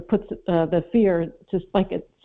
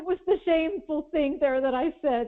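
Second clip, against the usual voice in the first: incredulous; 8 semitones higher than usual. Retold or imitated. Self-mocking rant about hatred.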